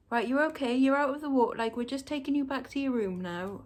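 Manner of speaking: a hint of a brisk carer; imitated reassuring staff. Retold staff reassuring waking patient.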